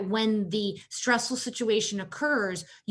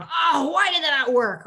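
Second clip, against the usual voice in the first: mock frustration; 6 semitones higher, 6 dB louder than usual. Mom frustrated at own task.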